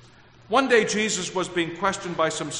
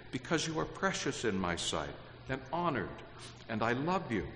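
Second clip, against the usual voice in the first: much softer, gentle reading of the promise; soft tender repeat; 5 semitones lower, 10 dB quieter than usual. Acted. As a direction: say it quietly, like a tender reassurance. Verse repeated softly as God's words.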